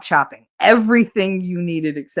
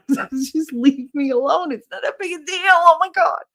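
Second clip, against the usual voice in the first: high, squeaky-pitched mock pleading; hammed-up high-pitched protest; mock exasperation; 11 semitones higher than usual. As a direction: act fed up way up high in your voice. Acting out exasperation at coworkers.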